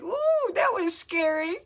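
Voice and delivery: put-on high squeaky frightened child voice; scared little kid. Telling friend how her inner child reacted, mock frightened child voice.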